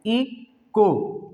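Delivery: इको drawn out slowly, ई then को, no h; silent h. Shown as a demonstration. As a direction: sound out इको in two slow pieces. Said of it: "एच को बिल्कुल छोड़ देते हैं". (इको, एच छोड़कर धीरे से)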